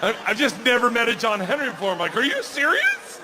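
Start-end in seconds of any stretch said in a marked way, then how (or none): 2.2-3.2 much higher, slow, laughing; laughing disbelief. Retold or imitated. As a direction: stretch it out in giddy amazement. Disbelief at the name John Henry.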